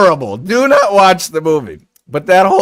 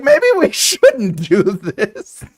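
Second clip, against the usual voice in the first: 慢慢地、迟疑地说出这句; spoken slowly and hesitantly; hesitant slow line; 4 semitones higher, 4 dB louder, much slower than usual. Retold or imitated. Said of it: "she's like".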